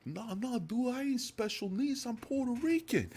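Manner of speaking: put-on Puerto Rican accent like a rapper; thick Puerto Rican accent. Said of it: "this really thick accent".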